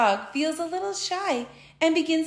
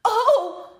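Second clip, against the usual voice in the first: high startled cry; startled gulp; 8 semitones higher than usual. A narrator's reading. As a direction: yelp as it hits. Pineapple hits Frog.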